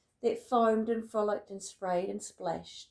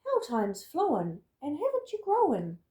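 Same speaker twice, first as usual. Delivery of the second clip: doting flock; 6 semitones higher, 4 dB louder than usual. Acted. Flock on the rock greeting the returning snail. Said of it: "who said".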